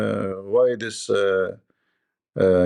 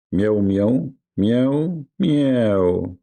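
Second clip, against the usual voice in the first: mock cat meows; much slower than usual. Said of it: "he says to Muhammad".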